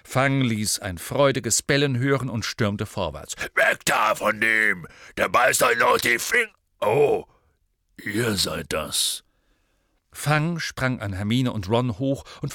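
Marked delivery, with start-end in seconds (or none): 3.6-9.2 slow, big character voice warning people off; loud gruff giant. A narrator's reading. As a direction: call a warning, then sink into sullen recognition. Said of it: "sagte eine laute Stimme".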